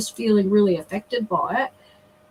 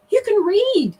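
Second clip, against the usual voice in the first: high, louder re-enacted amazement; amazed outburst, much higher in pitch; retold astonishment; 11 semitones higher, 4 dB louder than usual. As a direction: exclaim it like a mother reliving her shock. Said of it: "tears streaming down my face in absolute shock".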